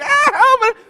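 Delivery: a stuck turtle whimpering for rescue; mock cry for help. Stuck under the van, pastor plays a squeaky mock plea. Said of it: "I'm like".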